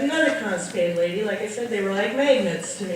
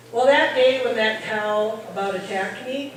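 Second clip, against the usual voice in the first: normal talking voice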